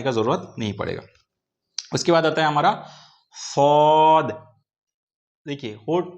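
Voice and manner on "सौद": सौद slow, heavy emphatic s; teacher drilling saad. Shown as a demonstration. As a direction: draw out सौद with the tongue to one side. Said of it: "जीभ को एक तरफ लेके जाना है".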